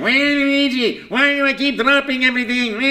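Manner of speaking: fretful and clumsy, always anxious; Luigi voice. Retold or imitated. Mornings he feels like Luigi, a high timid whine. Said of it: "a little bit more Luigi".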